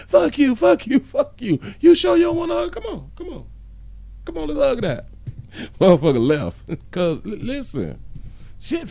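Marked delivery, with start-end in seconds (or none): 0.1-1.6 high, loud mock cursing; mock angry outburst. Insulter cursing back at an offered hug, mock angry. Retold comeback to a taunted insulter. 1.8-5.0 high-pitched mock coaxing; teasing coaxing. Teasing the name-caller with a hug, high playful coaxing.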